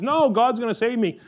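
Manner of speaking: high, loud, insistent brush-off; stubborn refusal. Retold or imitated. Man on the roof in a flood to rescuers, stubborn refusal. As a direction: call back a cocksure no.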